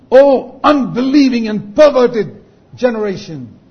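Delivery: loud, high, slow denunciation; preacher acting out an indignant rebuke; upset rebuke. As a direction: thunder it at them in dismay. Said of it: "almost think he's upset with these people".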